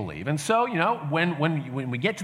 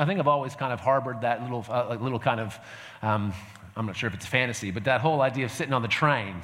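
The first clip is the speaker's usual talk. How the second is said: ordinary talk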